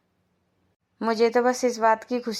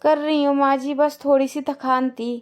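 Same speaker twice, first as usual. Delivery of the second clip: loud tired plea; 4 semitones higher, 7 dB louder than usual. Acted. Lajo answers the scolding.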